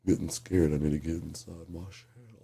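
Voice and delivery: very soft, timid mumble; mock scared whisper. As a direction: shrink into your shell, barely audible. Pretend turtle hiding in shell.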